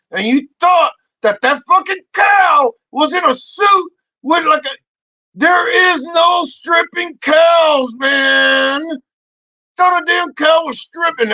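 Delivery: high, loud comic outrage; exasperated rant. Acted. Comic scolding Santi over the cow, exasperated shouting. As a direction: shout it in hammed-up disbelief.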